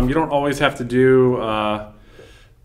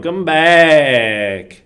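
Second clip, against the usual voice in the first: slow, higher, louder drawl; Matthew McConaughey voice; 5 semitones higher, 6 dB louder, much slower than usual. Pretend. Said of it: "I'm breaking it a little bit".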